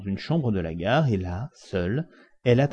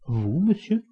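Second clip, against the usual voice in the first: higher, startled question; surprised cry; 7 semitones higher, slower than usual. A narrator's reading. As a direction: blurt it out in disbelief. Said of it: "s'écria Fix".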